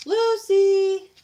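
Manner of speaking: loud high sing-song call. Real, not acted. Owner calls her napping dog from afar, sing-song holler. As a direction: holler for the dog in a long singing shout. Calling the sleeping dog over.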